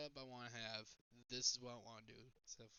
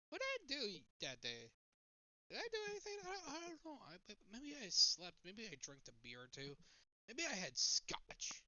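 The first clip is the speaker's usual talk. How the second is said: high, baffled, searching voice; mock-puzzled, voice jumping up; imitating confused self; 8 semitones higher than usual. To Chad, imitating his blanked-out self, puzzled. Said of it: "you're like".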